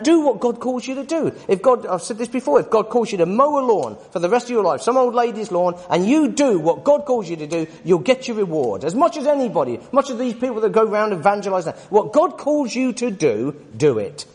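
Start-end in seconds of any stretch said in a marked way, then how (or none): none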